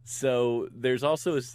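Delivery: normal talking voice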